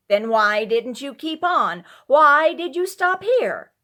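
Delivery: loud, high, exasperated demand; rising shrill exasperation. A narrator's reading. Wife demands why husband stopped at home, loud and high. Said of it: "demanded the wife".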